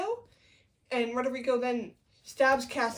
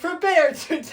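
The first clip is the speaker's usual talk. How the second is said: loud, higher-pitched hammy threat; mock battle cry; 6 semitones higher, 8 dB louder than usual. Avenger facing his enemy, mock battle cry. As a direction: shout it like a play-acted avenger.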